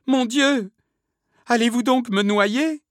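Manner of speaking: very high, loud panicked wail; acted desperate shriek; heart-rending cry. A narrator's reading. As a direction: cry out in terror of drowning. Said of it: "Ces cris avaient quelque chose de si déchirant".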